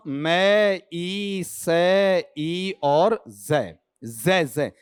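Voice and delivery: मैं, ई, से, ई, जय one sound at a time; phonetic spelling-out. Shown as a demonstration. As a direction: separate and stretch each sound from मैं to a buzzing जय. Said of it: "इसका उच्चारण कैसे लूंगा".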